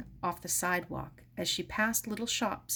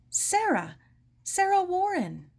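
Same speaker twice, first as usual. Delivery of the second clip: put-on eager girl; 12 semitones higher, 6 dB louder than usual. Girl announces herself at door.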